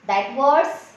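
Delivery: usual speaking voice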